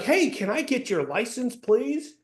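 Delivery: high, sing-song pleading request; un-coplike polite asking. Shown as a demonstration. Coach mimicking how cops never talk, high and over-polite. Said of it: "what way is the cop talking?".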